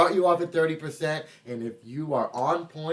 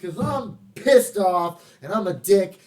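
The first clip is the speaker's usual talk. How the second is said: mock angry; 6 semitones higher, 7 dB louder, slower than usual.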